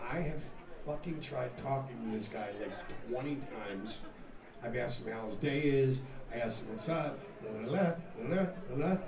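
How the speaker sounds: low, soft retold complaint; hushed, low-pitched venting; confiding aside. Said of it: "he pulled Nikki aside".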